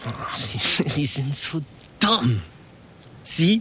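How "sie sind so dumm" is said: slow contempt. Acted. Boss insults secretary slowly.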